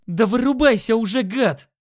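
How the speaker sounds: loud, high furious yell. A narrator's reading. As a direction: scream it at the enemy. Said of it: "заорал Димон". (яростный крик)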